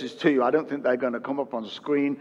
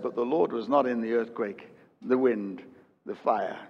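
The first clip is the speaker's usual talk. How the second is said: softer, lower reading; quiet, falling repetition; hushed; 5 semitones lower, 6 dB quieter than usual. Acted. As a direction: let the refrain drop to a hush. Hushed repeat of the refrain.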